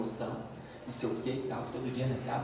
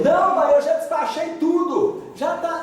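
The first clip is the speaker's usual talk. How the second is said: daughter answering; 11 semitones higher, 5 dB louder than usual. Retold or imitated.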